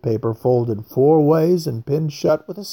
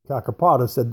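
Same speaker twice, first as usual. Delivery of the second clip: ordinary delivery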